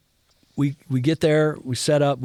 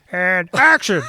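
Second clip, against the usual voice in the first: loud, high puppet-voice call; imitating a director's theatrical shout; Frank Oz; 7 semitones higher, 7 dB louder, slower than usual. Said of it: "it's either Yoda or Miss Piggy".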